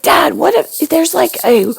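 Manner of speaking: a son asking his dad about monsters; imitating her son. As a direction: ask like an excited little boy. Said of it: "he's like".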